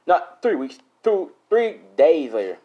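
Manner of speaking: "three days" hit hard and drawn out; emphatic self-correction. Real, not acted. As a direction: correct yourself loudly, slowing on "two, three days later". Emphatic correction of his own slip.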